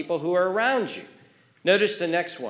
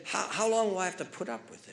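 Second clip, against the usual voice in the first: quoting Jesus; 3 semitones higher, 6 dB quieter, faster than usual. Retold or imitated. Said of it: "and he said".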